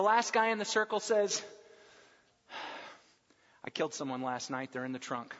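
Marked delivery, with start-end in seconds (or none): 3.6-5.3 low, flat-voiced deadpan; deadpan confession. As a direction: drop it low and matter-of-fact. Deadpan punchline from last guy.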